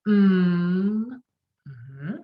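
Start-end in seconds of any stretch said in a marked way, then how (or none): 0.1-1.1 mm 을 입술 닫고 콧소리로 길게; 코로 울리는 m 소리; nasal mm. Shown as a demonstration. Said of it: "Everybody say".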